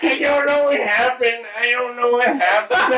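very high, whiny mock simpleton voice; playing dumb. Grandson denying blame to grandpa, high dopey whine. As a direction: squeal it innocently, breaking into laughter.

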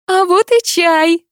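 high, loud, slow chirp; high cheery mother. A narrator's reading. Mother brings tea, high and loud. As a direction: sing it out like a hostess.

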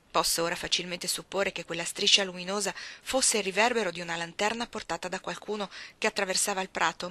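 ordinary delivery, nothing marked

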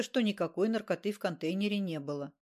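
ordinary delivery, nothing marked

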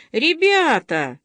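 high, drawn-out playful call; clownish cry. A narrator's reading. Masha rallies her quarrelling friends with a clownish cry. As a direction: call out to the gang, fooling around. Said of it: "дурашливо закричала она".

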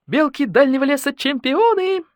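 high, excited sports chant; triumphant cheer. A narrator's reading. Older badger celebrates the squirrels' victory, cheering high. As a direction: cheer the winning team on.

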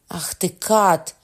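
slow, loud accusation; angry roar. A narrator's reading. Father cursing his son's killer, roaring. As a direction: roar the insult, drawing it out. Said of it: "заревів Меркандон".

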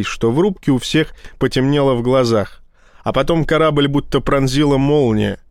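usual speaking voice

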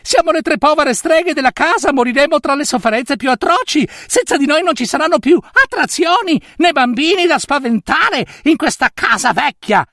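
voce alta e lamentosa che implora; streghe supplicanti. Acted. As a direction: supplica da megera in trappola. (le streghe implorano la nutria, voce acuta e supplichevole)

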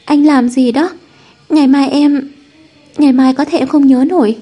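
a touch of a sob, voice lifted high; mildly tearful. A narrator's reading. Girlfriend overwhelmed by his firefly surprise, mildly tearful. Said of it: "nức nở nói".

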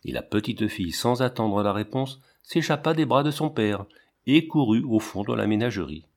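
ordinary delivery

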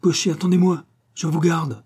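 urgent, high, loud call. A narrator's reading. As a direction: hail the departing driver. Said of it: "s'écria Guérac".

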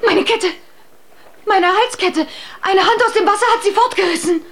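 panicked cry. Acted. Bertalda loses her necklace, crying out in panic. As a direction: cry out in shock at the loss.

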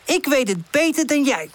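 high, boastful retort; defiant call. A narrator's reading. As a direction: throw it back in a quarrel. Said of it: "roept Woezel".

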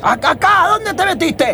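high shout across the cloud; calling out. Acted. Muguruza shouts to Fermín, lost in the cloud, high calling. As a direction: yell to a partner you can't see.

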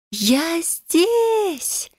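high desperate tearful cry; sobbing shout. Acted. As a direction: call out through sobs. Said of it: "в голос рыдая прокричала я".

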